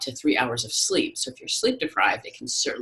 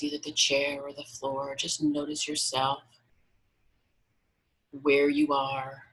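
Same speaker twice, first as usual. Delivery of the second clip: guided meditation. Acted. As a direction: guide them gently into relaxation, unhurried.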